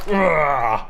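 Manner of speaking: loud action-movie grunt; hammed-up movie grunt; Predator grunt. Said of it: "Like Predator".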